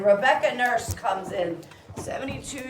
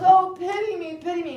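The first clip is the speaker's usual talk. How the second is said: mock pleading; 8 semitones higher, 6 dB louder than usual. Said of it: "And she goes".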